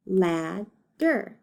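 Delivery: LADDER split in two, lad, then der; broken into syllables. Shown as a demonstration. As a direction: count out LADDER with a gap between syllables. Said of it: "decide how many syllables it has".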